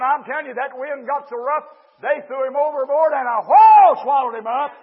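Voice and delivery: high, shouted storytelling from the pulpit; full-force preaching. Real, not acted. Preacher tells how the whale swallowed Jonah, full-force preaching. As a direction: drive the story home, loud and high.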